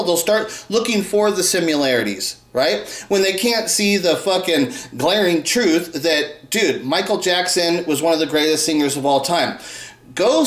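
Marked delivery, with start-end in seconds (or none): none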